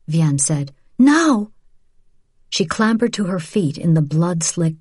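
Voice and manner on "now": sharp loud urgent command; urgent shout. Acted. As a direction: shout it to hurry her. Urgent escape, calling to Rachel.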